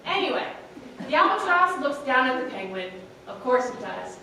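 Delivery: usual speaking voice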